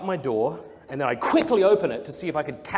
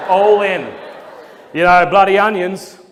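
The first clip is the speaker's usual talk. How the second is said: louder, higher hammed-up punchline; all-in joke; 4 semitones higher, 13 dB louder than usual. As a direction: commit fully to the bad joke. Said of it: "go all in on this bad joke".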